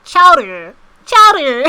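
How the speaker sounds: "Chowder" parroted loudly from the recording; "Chowder" with exaggerated American r, laughter breaking in; chowder pronunciation demo. Shown as a demonstration. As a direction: belt out "Chowder", mimicking a pronunciation guide.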